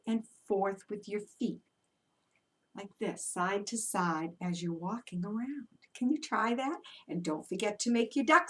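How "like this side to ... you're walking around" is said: soft. Shown as a demonstration. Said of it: "soft demo for kids".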